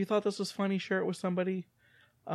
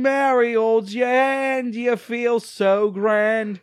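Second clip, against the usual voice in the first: hammed-up Cockney singsong, louder; 6 semitones higher, 8 dB louder, much slower than usual. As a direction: belt it out in a mock Cockney accent. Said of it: "my Cockney is maybe".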